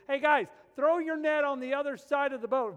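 loud, high call across the water; a commanding yell from the bank. Retold or imitated. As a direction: shout it out to the boat. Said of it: "So he yells at him".

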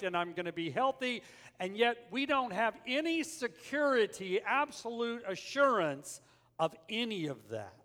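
loud, high-pitched sermon delivery; forceful preaching. Real, not acted. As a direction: preach it hard to the congregation. Preaches the point after the anecdote.

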